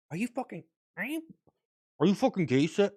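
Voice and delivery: half-formed Rick voice, broken off; a rough warm-up before the real Rick; first try at Rick. Acted. Streamer's false start at Rick, barely voiced. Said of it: "Oh, wait, wait".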